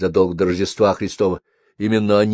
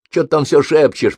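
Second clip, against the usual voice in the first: an irritated caller's complaint, raised voice; grumbling; 7 semitones higher, 6 dB louder than usual. A narrator's reading. Mikhalych complaining about whispering on the phone, loud grumble. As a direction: grumble loudly, pitched up. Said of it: "проворчал Михалыч".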